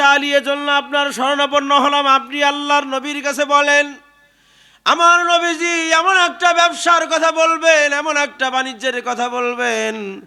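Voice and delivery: high, ringing sermon appeal; full-force preaching. Acted. Preacher voices a companion appealing to Ali, full-force preaching.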